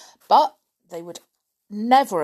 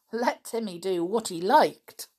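ordinary delivery

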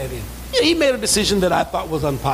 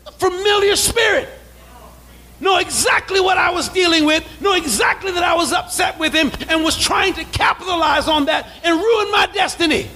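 preaching at full force; 10 semitones higher than usual. Real, not acted. Pastor warning the church about familiar spirits, full force. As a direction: drive the point home to the church.

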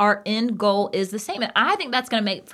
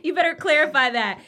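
mock-stern warning, pitched higher; 6 semitones higher than usual. Playfully warning her husband to clarify his jab, mock stern. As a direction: play-act a stern warning to your husband.

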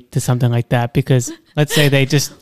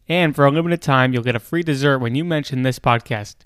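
smooth podcast sponsor read; 7 dB quieter than usual. Acted.

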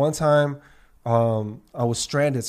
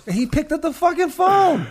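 high-pitched incredulous exclamation; amazed outburst; 4 dB louder than usual. Real, not acted. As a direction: voice shooting up in real disbelief. Amazed outburst at friend's loyalty.

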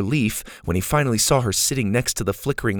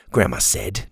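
usual speaking voice

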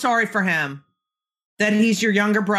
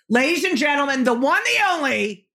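hype announcer; 7 semitones higher than usual. Acted. Host introduces guest like announcer.

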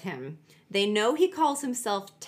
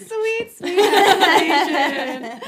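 high laughing drawn-out repeat of sweet escalation; laughing echo; 8 semitones higher, 12 dB louder, slower than usual. Real, not acted. Laughing at a friend's sweet escalation line, slow and high. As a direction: savor it through laughter, slowly.

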